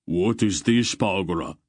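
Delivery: growled. A narrator's reading. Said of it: "he growled over his shoulder".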